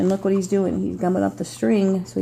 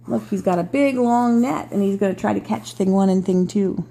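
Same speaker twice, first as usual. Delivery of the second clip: ordinary delivery, nothing marked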